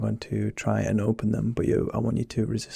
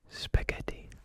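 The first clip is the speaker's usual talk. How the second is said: soft unvoiced whisper into one ear; 10 dB quieter than usual. Shown as a demonstration. Doctor whispering a test word to patient, whispered. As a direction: breathe the word close to the ear. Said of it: "Once more".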